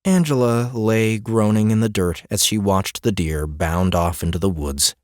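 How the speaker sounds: ordinary delivery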